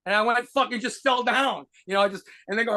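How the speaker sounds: ordinary delivery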